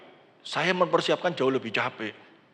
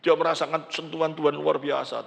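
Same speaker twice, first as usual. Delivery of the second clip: ordinary talk, nothing marked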